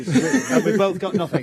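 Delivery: laughing through words. Real, not acted. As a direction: chuckle while saying it. Laughing at both losing.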